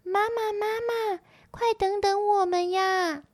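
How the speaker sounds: high-pitched little tadpole voice; tadpoles calling out. A narrator's reading. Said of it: "连声大喊".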